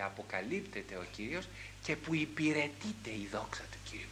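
voiceless, hushed whisper; reverent whisper. Acted. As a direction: breathe it out in awe. Reverent climax about God's glory. (ευλαβικός ψίθυρος)

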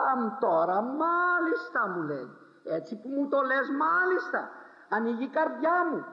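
light up with joy; delighted old woman. Retold or imitated. Simple believing sister answering the preacher with high delight.

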